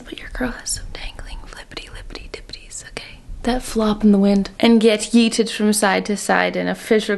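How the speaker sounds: conspiratorial whisper. Pretend. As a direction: lean in and confess it in a whisper. Confiding about leggings problem.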